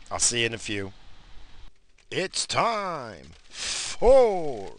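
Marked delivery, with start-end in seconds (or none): 2.1-4.7 very high, loud show announcement; announcer voice. Acted. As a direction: belt out the show name like a jingle. Show intro jingle call.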